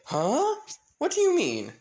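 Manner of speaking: high, loud startled outburst; acted stunned reaction; shocked. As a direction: blurt it out in disbelief, voice leaping up. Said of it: "pretty much everyone shocked".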